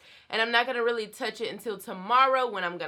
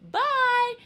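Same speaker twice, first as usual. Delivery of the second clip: high sing-song bye; 14 semitones higher, 5 dB louder than usual. Real, not acted. Sing-song goodbye to viewers.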